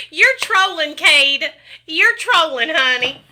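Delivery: high, loud and giggly teasing; amused teasing. Real, not acted. Streamer teasing a trolling viewer, high and laughing. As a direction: scold the joker through laughter.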